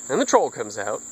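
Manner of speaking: plain reading voice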